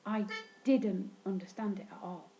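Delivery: ordinary talk, nothing marked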